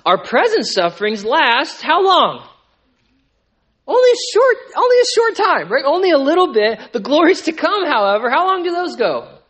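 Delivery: rising sermon call from the pulpit; full-force preaching. Acted. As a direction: preach it hard to the room. Preaching climax to the congregation.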